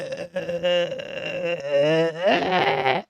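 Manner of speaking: long creaky droning noise; mock vocal fry. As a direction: croak out an exaggerated vocal fry, rising at the end. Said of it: "You don't talk like".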